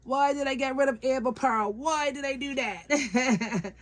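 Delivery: mock despair over getting rid of the doll; regretful wail. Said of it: "I'd be like".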